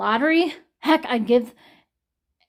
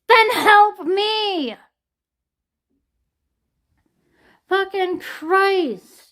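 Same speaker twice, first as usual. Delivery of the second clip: real anguished cry, much higher, louder and slow; begging the doctors at the top of her voice; drawn-out furious plea for help; desperate outcry; 8 semitones higher, 4 dB louder, much slower than usual. Real, not acted.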